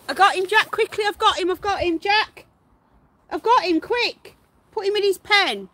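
loud, high, breathless calls of triumph; high, loud call to Jack after catching the pet; excited shouting. Real, not acted. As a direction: shout it out as you finally grab him. Catching the runaway guinea pig.

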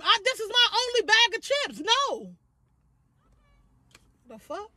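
high, indignant whiny complaint; annoyed. Acted. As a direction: sound put out and indignant. Annoyed at being woken up.

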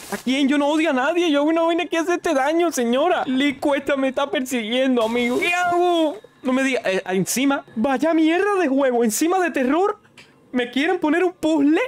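rattled player yelling while fleeing; frantic panic. Real, not acted. Old woman chasing him in game.

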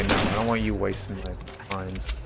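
ordinary talk

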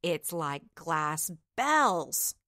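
slow, lightly wistful sigh; sighing in awe. Acted. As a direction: breathe it out in wonder. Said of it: "sighed the knight".

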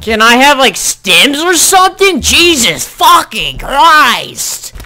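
high, loud exasperated outburst; raging gamer. Real, not acted. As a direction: lose it at the game. Raging at game.

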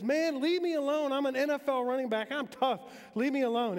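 high, loud, fed-up yell; shouting back. Retold or imitated. Dazed running back yelling at the linebacker to leave him alone, loud and high. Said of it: "as loud as he can".

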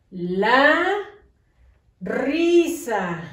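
La, a long pause, then risa; dictation with a long pause. Shown as a demonstration. Teacher dictating to pupils, pausing between words. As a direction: say La, hold the pause, then give risa clearly. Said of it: "hago la pausa".

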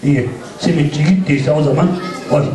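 ordinary talk, nothing marked

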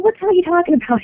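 fast, high, startled outburst; shocked disbelief. Retold or imitated. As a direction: blurt it out in shock. Shocked reply to strangling claim.

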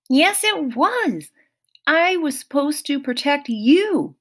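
a touch of remorse; lightly voiced sad satyr; guilty Grover. A narrator's reading. Grover blaming himself.

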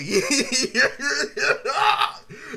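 a burst of high laughter; loud laugh. Real, not acted. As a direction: crack up at the memory. Laughing at the memory.

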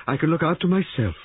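high, testy retort; snapped. A narrator's reading. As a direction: shut them out, pitched high. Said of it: "snapped Missus Boyle".

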